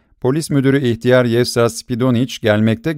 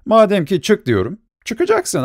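high, insistent command; a rowdy drunk throwing his weight around; bossy order; 10 semitones higher than usual. A narrator's reading. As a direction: voice leaps high on the final demand. Masked man orders banker out.

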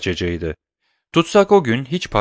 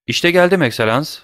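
eager, hurried bandit voice, pitched up; hasty bandit; 7 semitones higher, 4 dB louder than usual. A narrator's reading. Pepino hurrying to answer the prisoner, eager. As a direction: rush it out with put-on goodwill. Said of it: "iyi niyetli gibi görünen bir aceleyle".

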